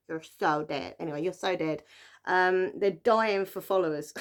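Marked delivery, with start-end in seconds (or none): 0.1-1.0 put-on American accent. Shown as a demonstration. Repeating the book title for fun, in an American accent. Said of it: "say it in that American accent".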